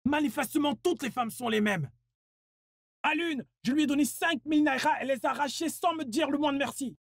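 high, aggrieved grumbling; bitter complaint. Acted. Man complaining that women take his money. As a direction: complain that nobody says thanks. Complaining after being robbed.

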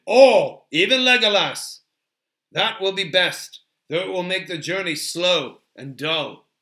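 loud, firm leader; commanding Aragorn. Acted. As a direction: settle the quarrel with forceful authority.

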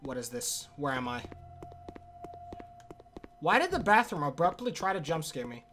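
ordinary talk

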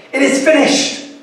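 high triumphant proclamation; a hint of a cry of triumph; victory cry. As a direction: announce it with exultant triumph. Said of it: "It was a victory cry".

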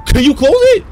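scared horror game streamer. Real, not acted. Begging door to close.

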